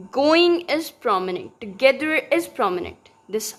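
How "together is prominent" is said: normal talking voice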